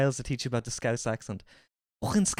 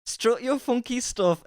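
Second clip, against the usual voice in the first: higher mock Geordie echo; copying Geordie; 7 semitones higher than usual. Streamer mimicking Sophie, mock Geordie. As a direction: copy the Geordie line. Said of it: "Let's hear it".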